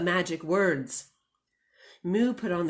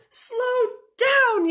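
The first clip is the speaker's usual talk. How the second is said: high, loud yell; 6 dB louder than usual. Acted. Minnie yells at Moo to slow the tractor. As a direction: yell it in a panic. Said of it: "yelled Minnie".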